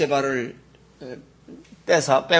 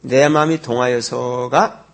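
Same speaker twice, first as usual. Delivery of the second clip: slow, louder read of the phrase; read-along emphasis; 6 dB louder, slower than usual. Shown as a demonstration. Pastor rereading the verse with the congregation, slow and louder. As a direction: lean slowly on every word. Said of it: "읽어보죠".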